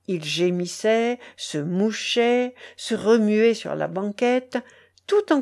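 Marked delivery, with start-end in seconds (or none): none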